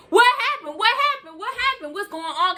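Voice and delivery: a worried mom shrieking questions; frantic mom. Retold or imitated. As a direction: play the frantic mother on the phone. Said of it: "your mama going off like".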